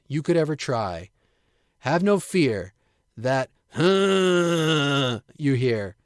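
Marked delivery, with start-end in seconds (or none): 3.7-5.2 long, drawn-out Frankenstein groan; monster grunt greeting. Acted. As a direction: moan out a wordless monster hello. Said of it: "is just him saying hi".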